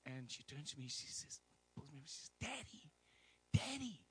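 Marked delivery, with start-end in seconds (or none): 2.4-4.0 put-on high, drawn-out little-girl wonder; little daughter's voice. Young daughter asking her dad how strangers know, high imitated child's voice. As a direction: imitate an amazed child, pitched way up.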